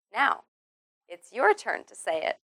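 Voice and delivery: usual speaking voice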